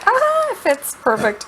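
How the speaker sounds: high, loud and delighted; happy cry of relief; excited. Real, not acted. Roast fits the pan.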